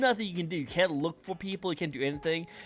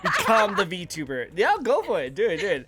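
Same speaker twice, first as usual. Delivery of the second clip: cheering; 5 semitones higher, 5 dB louder than usual. Real, not acted. Cheering friend on.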